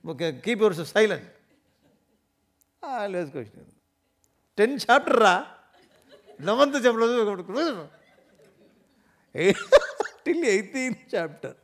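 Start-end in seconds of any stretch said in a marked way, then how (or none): 0.1-1.2 loud exasperated divine rebuke; mock-commanding outburst, raised voice; stern Krishna silencing Arjuna. Krishna to Arjuna who keeps questioning, exasperated rebuke. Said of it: "And Krishna says". 4.6-11.6 high, loud, incredulous and laughing; laughing exasperation. Acted. Teacher marvelling at Arjuna's endless questioning, laughing exasperation.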